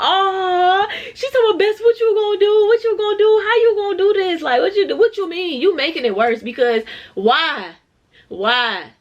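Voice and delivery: high, loud, squealing friend voice; frantic best friend. Retold or imitated.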